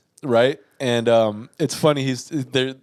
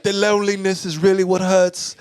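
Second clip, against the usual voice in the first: higher mock-dramatic voice; silly put-on voice; 6 semitones higher than usual. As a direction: ham up a brooding survivalist. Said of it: "I do the voice 'cause I think it's hilarious".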